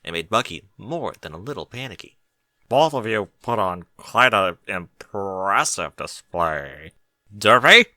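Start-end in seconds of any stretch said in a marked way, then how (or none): 2.7-7.9 slow, low purring voice; sultry mare. A narrator's reading. Barry praising both ponies, slow sultry purr. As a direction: lean in and savour each word.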